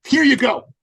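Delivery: high, loud and proud; proud handover. Retold or imitated. Man handing coach his money, proud. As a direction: hand it over beaming.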